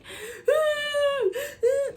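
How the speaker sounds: high, loud wailing imitation of a sob; mock baby crying. Retelling her happy tears at mom's reaction, mock wail. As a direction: wail like a baby, played for laughs. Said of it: "I started crying like a baby".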